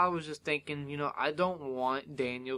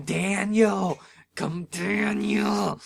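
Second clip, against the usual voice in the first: drawn-out, strained fan scream; retold screaming; 5 semitones higher, 5 dB louder, much slower than usual. Fan on the edge of the bed, screaming. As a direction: scream the name at the TV, stretched out. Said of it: "just screaming".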